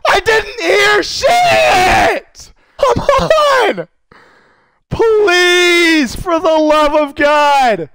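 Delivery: high-pitched, drawn-out wail; anguished wailing. Real, not acted. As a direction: wail and plead in despair. Losing the game again.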